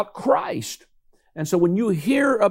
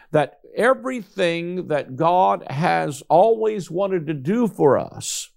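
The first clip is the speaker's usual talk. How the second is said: ordinary talk, nothing marked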